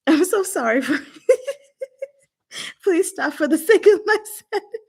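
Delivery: high, giggly real apology; helpless laughing. Real, not acted. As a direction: plead through laughter for it to stop. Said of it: "I can't stop laughing".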